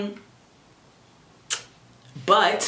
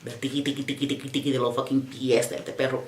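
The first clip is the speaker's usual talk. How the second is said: low, soft mock tapping; mocking clicking; 4 semitones lower, 5 dB quieter, faster than usual. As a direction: mimic the toenails clicking. Imitating dog toenails, annoyed.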